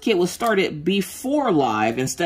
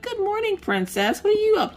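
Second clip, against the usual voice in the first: put-on much higher, tender sing-song to a child; sweet mommy greeting. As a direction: coo at your little princess. Mother greeting her little girl.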